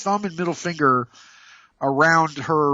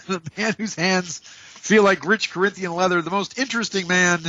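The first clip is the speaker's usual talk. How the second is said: grand, higher showman voice; announcer intro; 3 semitones higher than usual. Acted. Host introduces himself as the grand showman. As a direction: hype yourself up like a game show host.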